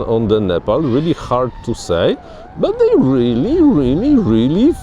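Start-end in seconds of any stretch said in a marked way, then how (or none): 2.6-4.7 high, stretched-out excited emphasis. Real, not acted. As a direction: draw it out with soaring enthusiasm. Enthusiastic verdict closing the flight test.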